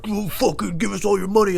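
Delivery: high put-on thug demand; mock robber voice. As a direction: play a burglar. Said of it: "and, like".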